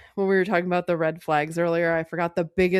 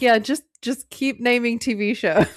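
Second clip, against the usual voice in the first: higher, mock-approving retort; sarcastic encouragement; 5 semitones higher, 4 dB louder than usual. Host mocking the character for listing crime shows, sarcastic. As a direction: egg him on sarcastically.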